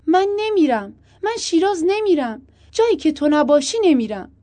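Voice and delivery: high, loud protest; insistent refusal. A narrator's reading. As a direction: refuse loudly, pitched right up. Wife refuses over the phone.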